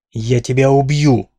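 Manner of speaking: hammed-up slow yell of rage. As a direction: roar the threat slowly. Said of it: "заорал Динар".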